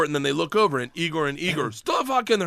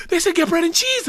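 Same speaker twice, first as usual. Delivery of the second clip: excited; 10 semitones higher than usual. Retold or imitated. Delighted at understanding, after gasp.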